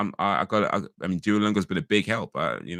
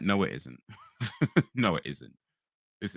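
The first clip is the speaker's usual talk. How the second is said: ordinary talk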